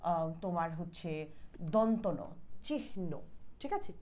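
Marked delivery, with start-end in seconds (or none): 2.7-3.1 চিহ্ন softly as chih-no; চিহ্ন with an audible h ahead of the n; h before n. Shown as a demonstration. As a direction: breathe the h in চিহ্ন, then say no. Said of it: "এরকম হ বইলে পরে ন".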